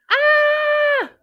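high, loud mock scream; startled storybook shriek; falling scream. A narrator's reading. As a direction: scream it as if dropping suddenly. Mouse falls through the floor.

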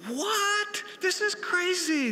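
real astonishment, voice jumping up; disbelief. Real, not acted. Speaker reacts to coach's cursing.

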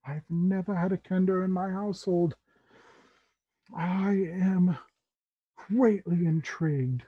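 measured, theatrical fascination; intrigued Tabaxi uncle. Acted. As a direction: savor the new guest, pausing between phrases. Tabaxi host welcomes Amulet.

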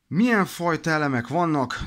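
usual speaking voice

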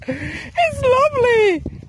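high, slow shout of delight. Real, not acted. Owner thrilled by the swollen river, high and slow. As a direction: cry out with joy at the high river.

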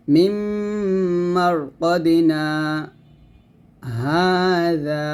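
very slow recitation with a breathless pause before হাযা; drawn-out tajweed reading with a brief stop; saktah recitation demo. Shown as a demonstration. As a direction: hold the voice still after কদিনা, then হাযা. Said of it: "এরকমভাবে আপনাকে পড়তে হবে".

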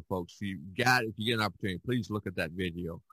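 usual speaking voice

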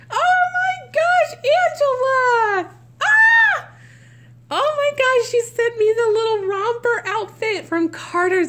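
very high, loud shrieks of delight; real ecstatic outburst; overjoyed squealing. Real, not acted. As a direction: squeal as if the gift floors you. Overjoyed at a surprise gift.

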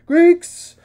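very high, very loud battle shout; rally the Greeks over the din; shouted rallying cry. A narrator's reading. A Greek warrior shouting to comrades across the battlefield. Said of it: "someone shouted".

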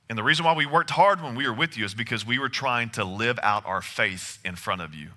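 usual speaking voice

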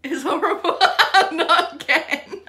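laughing out loud while speaking. Real, not acted. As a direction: burst out laughing through the words. Mum laughing at child's reaction.

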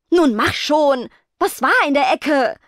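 angry demand to spit it out; snapping. A narrator's reading. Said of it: "fauchte Rebecca".

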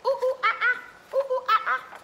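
high monkey calls, ooh ooh ah ah; monkey sounds. Shown as a demonstration. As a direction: make the monkey noise for the kids. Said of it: "What sound do the monkeys make?".

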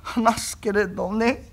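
very slow, bashful invalid; shy sickly voice. Acted. Young master fears Kuma will laugh, shy and slow. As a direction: hesitate like a shy sick boy.

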